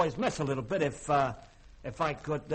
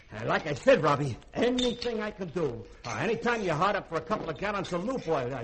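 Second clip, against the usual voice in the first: usual speaking voice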